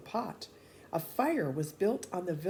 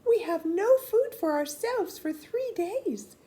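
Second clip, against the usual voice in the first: high, louder put-on whine of a stingy peasant; a peasant pleading poverty, voiced high and loud; Paul; 12 semitones higher, 7 dB louder than usual. A narrator's reading. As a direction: pitch it up and plead poverty loudly. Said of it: "said Paul".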